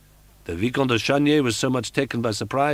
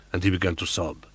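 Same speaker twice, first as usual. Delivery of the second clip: plain reading voice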